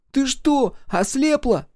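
put-on very high boy's outburst; exasperated child. A narrator's reading. Микки snaps at sister, exasperated. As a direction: cry out at a sister who won't see.

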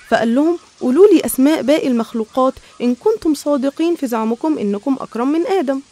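plain reading voice

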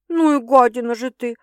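mildly groaned reproach; groaning. A narrator's reading. Girl scolding her teasing sister, groaning. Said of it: "простонала девушка".

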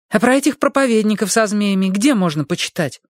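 put-on a young girl asking eagerly; girl's voice. A narrator's reading.

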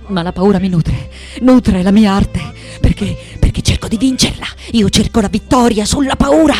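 loud, driving build to a cry; defiant declaration. Acted. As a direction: push through fear towards triumph. Defiant declaration of victory over fear. (sfida gridata, in crescendo)

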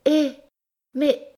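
surprise craintive. A narrator's reading. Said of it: "dit-elle avec surprise mêlée de crainte".

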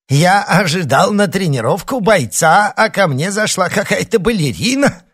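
loud and high, laughing through it; laughing old man. A narrator's reading. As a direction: roar it out between laughs. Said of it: "хохотал старик".

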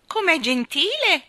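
high, loud, drawn-out delight; trilled. A narrator's reading. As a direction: trill it sweetly and loudly. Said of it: "trillò Nelly Rubina". (trillato, acuto e prolungato)

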